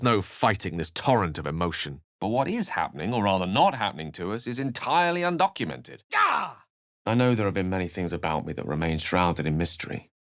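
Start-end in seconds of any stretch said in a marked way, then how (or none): 6.1-6.6 startled high cry. Acted. Sudden exclamation in game.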